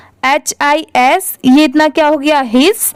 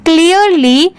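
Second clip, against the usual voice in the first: normal talking voice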